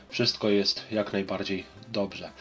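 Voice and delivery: usual speaking voice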